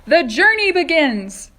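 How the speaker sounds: high, loud, slow proclamation; grand shout. Acted. Centipede proclaiming the start of the trip, slow loud shout. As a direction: announce it to all. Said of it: "shouted Centipede".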